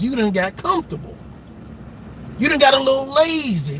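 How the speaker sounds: ordinary talk, nothing marked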